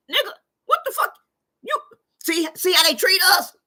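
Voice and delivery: high, fast, loud outburst; outraged shout. Retold or imitated. Streamer replaying her angry outburst in line. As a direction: yell it in outrage. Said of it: "I said".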